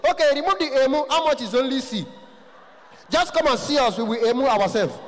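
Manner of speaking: high-pitched indignant haggling; poor man. Retold or imitated. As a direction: protest the price in a high voice. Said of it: "voice is always very high".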